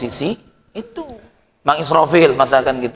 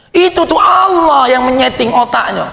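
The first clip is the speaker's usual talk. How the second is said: preaching shout; 10 semitones higher, 5 dB louder than usual. Acted. Preacher rising to climax.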